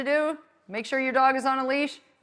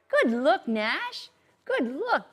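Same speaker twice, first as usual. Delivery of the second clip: praising the dog; 4 semitones higher, much slower than usual. Shown as a demonstration. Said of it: "I'm gonna show you how that's done".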